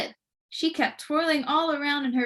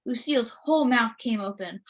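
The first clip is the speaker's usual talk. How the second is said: plain reading voice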